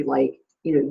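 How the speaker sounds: ordinary talk, nothing marked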